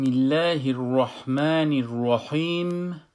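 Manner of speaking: slow, measured Basmala recitation; recited invocation. Real, not acted. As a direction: recite it with drawn-out long vowels. Opening invocation recited slowly. (تلاوت آهسته و کشیده)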